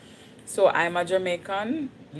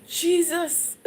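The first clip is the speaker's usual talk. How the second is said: much higher, panicked call to Jesus; alarmed cry; 13 semitones higher, 3 dB louder than usual. Retold or imitated. Telling viewers the earthquake woke her, panicked high cry. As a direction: cry out as the ground starts shaking.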